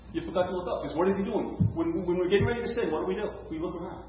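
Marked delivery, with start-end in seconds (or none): none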